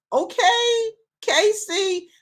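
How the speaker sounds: high, delighted cry on okay; light up at the city name; excited okay. Real, not acted. Host reacting to Kansas City viewers, delighted high okay.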